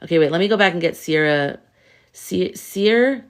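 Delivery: ordinary talk, nothing marked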